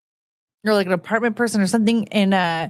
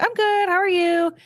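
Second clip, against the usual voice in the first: very high polite hello; chirpy cashier hi; 10 semitones higher than usual. Retold or imitated. Cashier greets customer, high and chirpy. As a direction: sing out the hi like a cashier. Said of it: "And I'm like".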